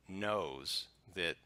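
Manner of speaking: ordinary delivery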